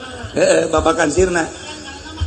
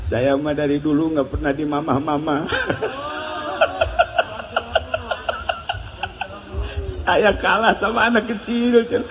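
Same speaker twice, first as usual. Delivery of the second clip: laughing complaint; 4 dB quieter than usual. Retold or imitated.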